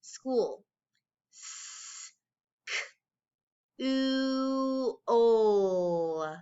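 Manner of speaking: "School" then "S, k, oo, o" one sound at a time, S whispered. Shown as a demonstration. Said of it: "Let's stretch out the sounds together".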